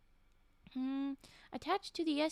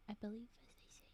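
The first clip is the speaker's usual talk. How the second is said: whispering; 3 semitones lower, 14 dB quieter, faster than usual. Real, not acted. Muttered aside.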